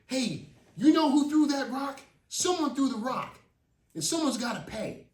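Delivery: high, loud demand; angry accusation. Retold or imitated. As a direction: confront them hard. Rick confronting the kids.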